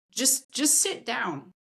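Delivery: slow, gentle "just sit down"; slow calm instruction. Retold or imitated. Coach telling a mom to sit down, slow and calm. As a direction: ease each word out slowly. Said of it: "But I said".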